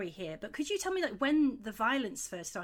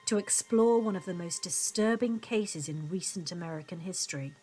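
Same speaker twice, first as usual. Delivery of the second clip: measured, ominous podcast narration; true crime narrator; 3 dB louder than usual. Acted. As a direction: read it like a dark documentary. Scripted podcast intro and narration.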